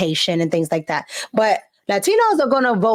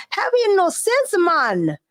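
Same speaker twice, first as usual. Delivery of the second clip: high-pitched start in a mock Jamaican accent; 8 semitones higher, slower than usual. Mimicking candidate on a talk show, mock Jamaican accent. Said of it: "I guess, her Jamaican accent".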